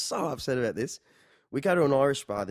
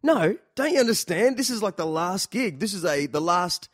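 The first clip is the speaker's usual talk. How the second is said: high, loud pleading, winding down; exasperated plea; 7 semitones higher, 5 dB louder than usual. Retold or imitated. Narrator pleading with his guitarist at the last gig, exasperated. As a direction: beg him like it's the last night.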